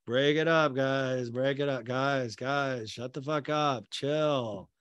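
mediate without any urgency, barely raising the voice; mock laid-back deadpan; imitated unbothered calm. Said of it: "I don't think his heart rate even got".

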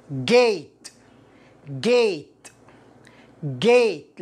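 "gate" with a drawn "ay" vowel. Shown as a demonstration. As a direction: model "gate" deliberately, falling each time. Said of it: "فال A هنا تكسره". (نطق "gate" بكسر الـA)

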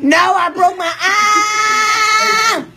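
loud protest held long at the end; yelling. Real, not acted. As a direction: yell it out, stretching "arm". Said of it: "No more yelling".

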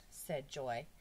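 usual speaking voice

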